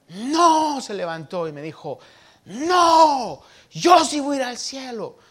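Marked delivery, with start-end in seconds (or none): 0.1-0.8 high, loud, protesting NO; child's indignant shout. Retold or imitated. Offended childhood friend shouting back at young Martín. Angry child denies going to hell. 2.4-5.2 high, loud, protesting NO; child's indignant shout. Retold or imitated. Offended childhood friend shouting back at young Martín. Angry child insists he'll reach heaven.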